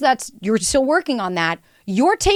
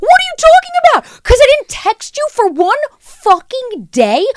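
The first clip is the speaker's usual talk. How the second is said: high, loud panicked disbelief; bewildered guy; 13 semitones higher, 8 dB louder than usual. Retold or imitated. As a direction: squeak out the panic. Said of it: "this poor guy is going".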